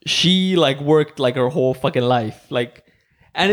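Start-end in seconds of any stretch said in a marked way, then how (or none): none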